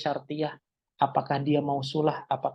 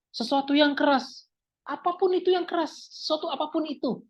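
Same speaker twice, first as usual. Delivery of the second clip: raised-pitch sample request; example request to friend; 10 semitones higher than usual. Shown as a demonstration. Asking a friend for something hard, high-pitched example request. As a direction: pitch it high, stressing that anything will do. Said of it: "saya ngomong sama teman saya".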